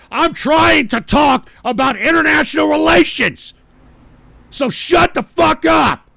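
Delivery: loud, high-pitched furious order; real rage, slowing on the last command; angry shouting. Real, not acted. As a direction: yell the chat into silence. Orders chat to be quiet.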